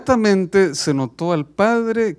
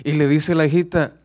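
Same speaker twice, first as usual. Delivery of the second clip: ordinary talk, nothing marked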